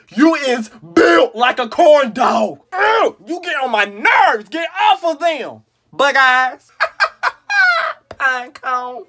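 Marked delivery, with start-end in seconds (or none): 0.1-2.6 slow, drawn-out insult; slow drawn roast. Pretend. Roasting a friend with slow drawn insult. 6.8-8.1 high laugh burst. Real, not acted.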